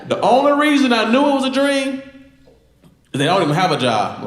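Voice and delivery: loud, high-pitched punchline; shouted punchline, voice way up; belted punchline. Acted. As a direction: yell the payoff high and loud.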